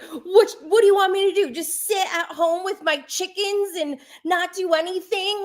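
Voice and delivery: high-pitched whiny imitation of a grown man's tantrum; exaggerated high, indignant protest; mock tantrum. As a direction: mimic him throwing a petulant fit. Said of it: "basically having a tantrum".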